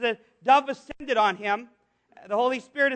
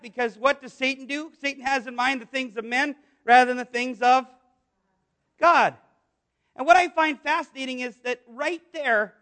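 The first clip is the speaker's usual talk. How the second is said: ordinary talk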